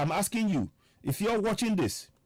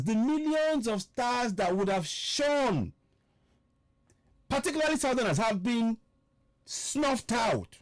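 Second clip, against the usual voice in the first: higher-pitched declamation with weighty pauses; impassioned oratory; 5 semitones higher than usual. Real, not acted. Broadcaster laments stars snuffed out in Nigeria, impassioned oratory. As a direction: drive each phrase home, pitched up. Rousing political oratory to listeners.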